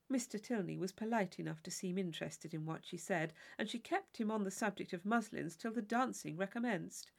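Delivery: plain reading voice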